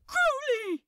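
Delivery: calling out. A narrator's reading. Angel shouting to the demon across the pond. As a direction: cry his name at full voice.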